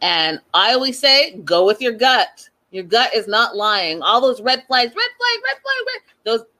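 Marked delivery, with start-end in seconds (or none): 5.0-6.0 singsong alarm. Pretend. Alarm chant about red flags.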